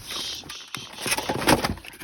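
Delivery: whispered shushing. Real, not acted. Shushing a loud noise.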